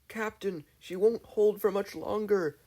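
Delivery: high, slow urgent call; sailor's yell. A narrator's reading. Jasper warning the captain in a storm, yelled. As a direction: warn the captain over the storm. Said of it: "yelled Jasper".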